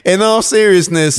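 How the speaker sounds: holding back laughter; forced serious tone; trying not to laugh. Real, not acted. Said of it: "I'm trying to be serious".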